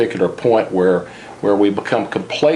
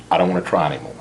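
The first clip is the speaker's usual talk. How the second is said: lower, understated defeated voice; resigned giving up; 5 semitones lower, 4 dB quieter, much faster than usual. Acted. Coach voicing someone after financial crisis, mildly resigned. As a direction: lightly act out someone who has given up. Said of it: "what I call the financial death rattle".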